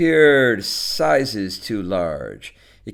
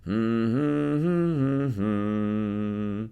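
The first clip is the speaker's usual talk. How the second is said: idle tuneful hum; man humming while busy with something; much slower than usual. A narrator's reading. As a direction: hum a few long notes to yourself. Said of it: "Mister Lighthill humming as he mixed".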